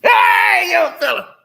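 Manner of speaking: loud high holler; old folks greeting. Retold or imitated. Old man greeting a young fella, holler. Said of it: "That's just how it is".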